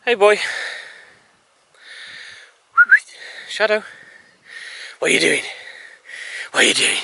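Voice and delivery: high-pitched playful greeting to a dog; dog talk. Real, not acted. Owner playfully asking Shadow what he's doing, dog talk. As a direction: pitch right up, teasing the pup.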